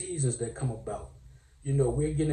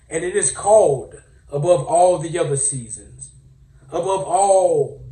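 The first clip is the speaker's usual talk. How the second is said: pastorish; 8 dB louder than usual. Pretend. Comic pastor announcing the greatest season, booming mock sermon. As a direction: proclaim it like a pastor building up. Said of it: "I feel a little pastorish".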